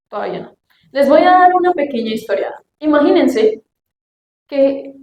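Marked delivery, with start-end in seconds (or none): 0.1-0.6 "todavía no" repeated much softer, trailing off; "todavía no" murmured softly to herself. Shown as a demonstration. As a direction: drop the second "todavía no" to a soft aside.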